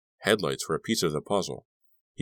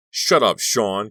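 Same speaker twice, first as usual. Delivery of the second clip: slow, loud, higher furious scream; 5 semitones higher, 8 dB louder, much slower than usual. A narrator's reading. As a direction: snap it out, losing control, dragging each word. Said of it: "Kerry screamed".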